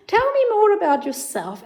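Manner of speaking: put-on high, lilting child-directed voice; therapist addressing a child. Therapist talks to the child's house puppet, high and lilting. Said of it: "So then as a therapist, you can say".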